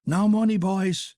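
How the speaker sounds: high, brisk hail to the room; lively hello to the lads; bustling greeting. Acted. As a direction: burst in with cheer. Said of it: "bustling middleman".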